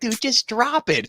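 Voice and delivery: high, slow exasperated quote; exasperated plea. Retold or imitated. Co-host retells urging wrestler to drop accent, exasperated. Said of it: "and I'm like".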